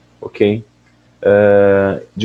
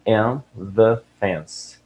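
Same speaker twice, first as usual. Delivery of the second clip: slow read-out; 3 dB quieter, much slower than usual. Shown as a demonstration. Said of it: "aí ele fala".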